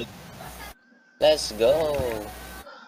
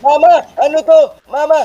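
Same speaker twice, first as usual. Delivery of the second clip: panicked calling; 11 semitones higher, 6 dB louder than usual. Real, not acted. Panicked calls in horror game.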